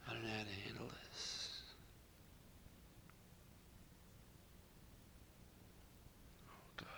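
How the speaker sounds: very soft, with long silences; overcome, hushed. Retold or imitated. Priest stunned by a dying woman's news, overcome and hushed. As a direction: barely voice it, at a loss for words. Storyteller overcome by what she said.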